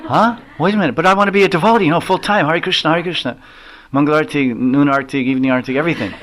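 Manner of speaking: high, fast pleading disciple; eager protest. Retold or imitated. Disciple protesting to Swamiji that he wants temple life, high and hurried.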